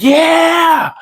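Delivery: high loud shout; high, loud eager shout; eager yell. Retold or imitated. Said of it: "I'm like".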